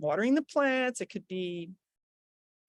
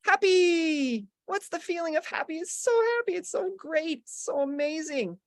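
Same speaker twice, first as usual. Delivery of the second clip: much higher, gushing joy; demo happy; 7 semitones higher than usual. Shown as a demonstration. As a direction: lift the voice into delight. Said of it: "So everybody, happy".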